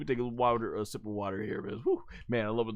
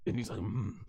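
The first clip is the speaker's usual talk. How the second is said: hushed gasp; 7 semitones lower, 7 dB quieter than usual. Retold or imitated. Building to a tantrum.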